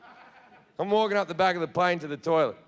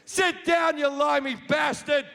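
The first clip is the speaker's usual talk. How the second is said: lightly voiced New York heckler, a touch higher; northern New York accent; 5 semitones higher than usual. An angry passenger heckling the comedian, in a light New York accent. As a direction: shout it like a New York heckler throwing peanuts. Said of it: "good with all these different American accents".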